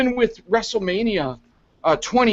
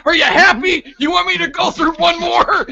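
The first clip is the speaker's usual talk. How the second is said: higher, louder mock Ryback shout; mock yell; 11 semitones higher, 6 dB louder than usual. Ryback yelling at Vince after the table, mock shout. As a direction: yell it like a fed-up wrestler.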